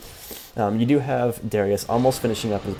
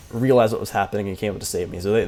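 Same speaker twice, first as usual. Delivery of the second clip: usual speaking voice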